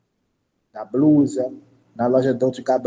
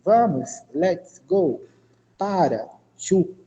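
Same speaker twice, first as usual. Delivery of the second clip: slow, each word set apart; word-by-word teaching; slower than usual. Shown as a demonstration. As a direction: give each word and its translation slowly. Teacher matches words with translations. (tradução pausada, palavra por palavra)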